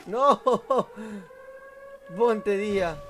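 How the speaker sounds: high, loud outcry; despairing shout. Real, not acted. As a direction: shout in dismay at the lost game. Lost all his teammates.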